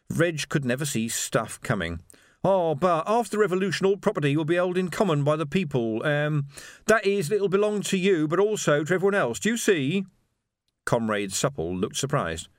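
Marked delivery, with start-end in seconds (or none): 2.4-10.0 high, eager explaining voice; earnest revolutionary. A narrator's reading. Revolutionary explaining common property to a shoemaker, earnest. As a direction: explain the cause in a high, keen pitch.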